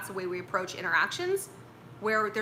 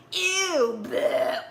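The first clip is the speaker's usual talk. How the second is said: mock disgust; 7 semitones higher, 4 dB louder than usual. Imitating a disgusted rejecting guy.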